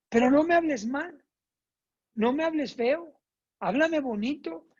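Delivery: a daughter begging not to be spoken to harshly; daughter's complaint. Retold or imitated. Hija regaña a su mamá, quejosa y aguda. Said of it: "ella me dice".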